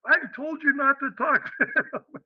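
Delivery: high-pitched imitated exasperation; mock scolding. Colleague hears of the run-in, mock scolding.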